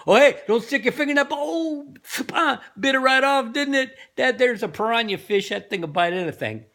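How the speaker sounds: high, fast, laughing yokel barker; Jonathan Winters routine. Retold or imitated. Zoo owner laughing at a visitor's bitten finger, high and fast. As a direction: squeal it out, cackling, as the piranha bites. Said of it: "that's the Jonathan Winters thing".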